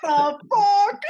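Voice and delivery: loud, high, drawn-out protest; laughing outrage. Real, not acted. Host told the accent is a pass, loud laughing outrage. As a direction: shout in disbelief through laughter.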